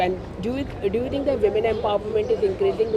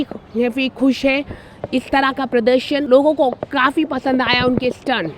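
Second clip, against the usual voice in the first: brisk, louder TV news voice-over; news report read; 3 semitones higher, 7 dB louder, much faster than usual. Acted. Reporter wrapping up the Mumbai story for viewers, fast louder news read.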